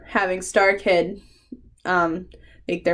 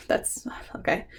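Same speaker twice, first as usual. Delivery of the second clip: breathy whispered aside; a hushed whispered okay; 10 dB quieter than usual. Real, not acted. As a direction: whisper it to yourself, stunned. Stunned aside.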